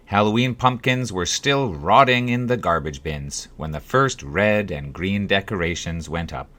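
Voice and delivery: plain reading voice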